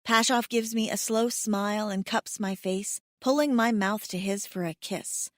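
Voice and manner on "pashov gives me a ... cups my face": ordinary delivery, nothing marked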